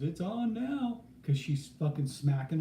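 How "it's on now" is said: high, hammed-up "it's on now"; high hammed-up challenge; mock bus driver threat. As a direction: play the driver squaring up, half-laughing. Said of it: "he goes".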